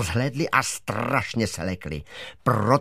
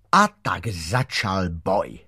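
ordinary delivery, nothing marked

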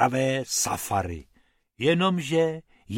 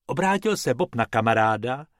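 plain reading voice

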